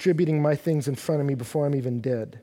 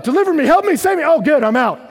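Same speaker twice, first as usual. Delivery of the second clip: very high, loud acted plea and relief; excited cry; 10 semitones higher, 8 dB louder, faster than usual. Preacher acting the psalmist's rescue, excited. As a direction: cry out then burst with relief.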